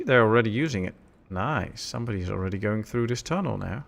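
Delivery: ordinary delivery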